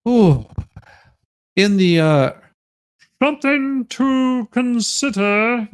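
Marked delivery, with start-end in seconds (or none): 3.2-5.7 mocking read. Mocking the email's phrase.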